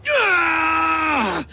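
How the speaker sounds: long high-pitched shriek; frustrated scream. A narrator's reading. Boy raging at Ma, long high scream. Boy's wordless scream of rage.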